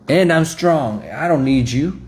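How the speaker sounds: loud, high mock bravado; haughty insistence. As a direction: declare it like someone proudly refusing help. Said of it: "proud and haughty".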